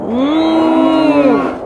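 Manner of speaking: high, loud, drawn-out moo; moaning cow. A narrator's reading. Cow moans at the wind from the barn, high loud moo. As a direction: let a cow's moan swell. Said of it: "cow moaned".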